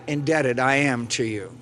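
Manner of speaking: ordinary talk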